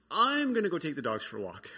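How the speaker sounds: storming off in mock frustration; a mock-exasperated dad walking out; fed-up announcement. Exasperated decision retold.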